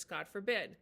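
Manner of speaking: ordinary delivery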